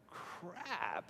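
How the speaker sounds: crap breathed out soft and falling, almost silent; muttered curse. Retold or imitated. Pastor hearing a marriage is a two, a soft muttered crap. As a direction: sigh out crap under the breath. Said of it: "I'm like going".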